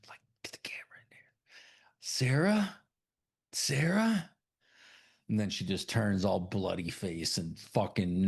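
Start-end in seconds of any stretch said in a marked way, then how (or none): 0.1-1.3 voiceless, fast whisper. Retold or imitated. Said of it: "And she's like". 2.0-4.3 higher, hesitant calls. Retold or imitated. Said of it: "And she's like".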